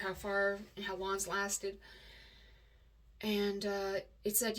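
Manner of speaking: normal talking voice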